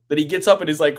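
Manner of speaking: normal talking voice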